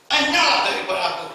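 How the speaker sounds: loud, stern command; loud divine dismissal; stern rejection. Acted. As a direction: cast him away. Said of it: "Siapa dia bilang?".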